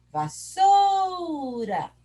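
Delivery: SOU in "Vassoura" stretched very long and high; calling from afar. Shown as a demonstration. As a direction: call "Vassoura" into the distance, holding SOU. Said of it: "Vamos chamar longe".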